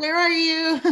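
mock panicked call. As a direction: call out, scared but giggling. Husband calling for his wife.

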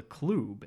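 "club" with a long oo vowel; "club" pronounced "kloob". Retold or imitated. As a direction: say "club" to rhyme with tube. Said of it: "that's a club".

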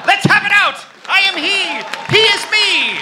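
high, loud theatrical shout. Acted. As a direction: bellow the confession to the hall. Dramatic confession shouted.

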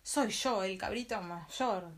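loud, slow, bold big goat; big goat facing the troll, loud and slow; very strong voice. Acted. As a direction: answer loud and unafraid. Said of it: "dijo con voz muy fuerte".

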